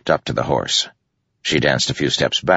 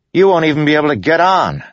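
high, loud, quick dismissal; dismissive Yennet; 12 semitones higher, 5 dB louder, faster than usual. Acted. Yennet doubts Kaladin can mount the horse, dismissive and high. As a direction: scoff at his chances, pitched well up.

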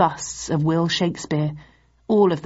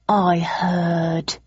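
feigned sadness. Acted. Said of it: "with feigned sadness".

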